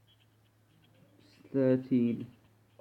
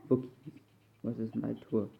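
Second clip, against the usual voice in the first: ordinary delivery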